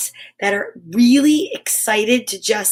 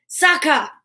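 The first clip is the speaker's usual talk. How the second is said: ordinary delivery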